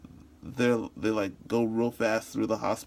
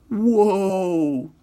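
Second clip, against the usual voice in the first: loud, high, long-held exclamation; stunned friend; 9 semitones higher, 10 dB louder than usual. Acted. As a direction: draw it out in loud amazement. Friend sees the broken finger.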